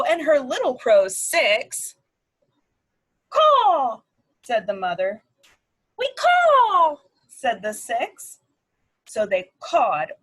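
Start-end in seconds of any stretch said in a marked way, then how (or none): 3.3-4.0 high, loud crow call; mother crow caw. Acted. Mother crow voiced in story. 6.0-7.0 high, loud little crows; baby crows cawing. Acted. Baby crows voiced in story.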